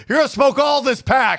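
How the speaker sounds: shouted parent scolding; mock angry parent. Parent catching kid smoking, mock shout. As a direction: yell it like a furious dad.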